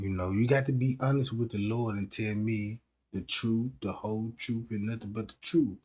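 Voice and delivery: a hushed confessor coaxing; priest. Acted. Pope urges full confession.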